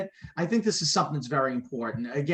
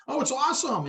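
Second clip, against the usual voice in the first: mock eager gush; 9 semitones higher than usual. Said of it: "I made the mistake of".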